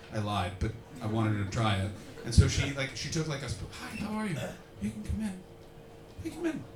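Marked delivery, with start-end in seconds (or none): 3.8-6.6 soft, high-pitched invitation; quiet little raised-pitch greeting; coaxing welcome. Real, not acted. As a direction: gently beckon a shy latecomer in. Coaxing a latecomer inside.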